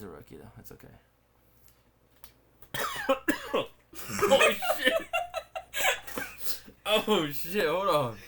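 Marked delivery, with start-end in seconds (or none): 2.6-3.7 sudden harsh cough, wrong pipe; choking cough. Real, not acted. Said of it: "saliva or some shit went down the wrong pipe". 4.3-8.2 startled and loud after choking; recovering from choking. Real, not acted. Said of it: "went down the wrong pipe".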